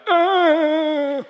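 a sudden overwhelmed sob; tearful cry. Retold or imitated. Pastor relives crying out in bed, high tearful gasp. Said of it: "and I'm like".

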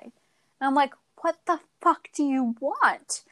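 ordinary delivery

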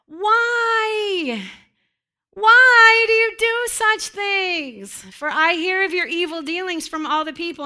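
loud, high, drawn-out whine; whiny voice. Acted. Eli pleads with his wayward sons, whiny. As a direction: wail it like a powerless whining parent. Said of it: "I'm putting my whiny voice on".

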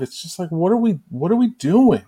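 pleading at the coaches in disbelief; exasperated rant. Real, not acted. Fan venting at the coaching, rising disbelief.

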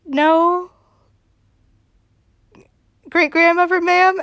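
put-on high, timid little-boy answer; a cowed child minding his manners; boy answering great-grandmother, high and meek; kid Spencer voice. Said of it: "he's like".

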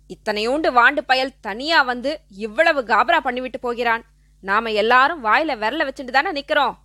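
plain reading voice

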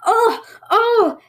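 high, loud panicked cries; alarmed cry. Acted. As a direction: cry out as the boat sinks. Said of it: "cried the tanuki".

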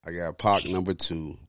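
normal talking voice

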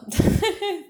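sudden high cackle; bright peal of real laughter; high burst of laughter. Real, not acted. Laughing at the chaos.

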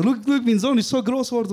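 high, proud showing-off voice, lightly voiced; a proud father bragging about his son; proud dad. A proud father shows off his son on webcam, lightly voiced. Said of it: "Und er so".

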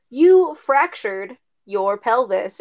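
lightly voiced doctor, slow and deliberate; slow doctor diagnosis. Doctor telling the patient her injury, slow and deliberate. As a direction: spell out the bad news slowly.

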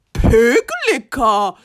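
low, slow, drawn-out cow bellow; Cow shouting. A narrator's reading. Cow shouting from her shed, low slow bellow. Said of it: "shouts Cow from her shed".

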